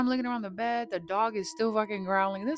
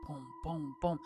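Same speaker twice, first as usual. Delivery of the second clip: soft low knocking booms; imitated thuds; 3 semitones lower, 7 dB quieter than usual. Bed banging under them, imitated with soft thuds. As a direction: copy the bed thumping, low and hushed.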